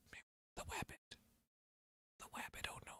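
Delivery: soft whispered dismay; hushed whisper. Acted. Whispering in mock grief over the rabbit. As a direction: barely breathe it out.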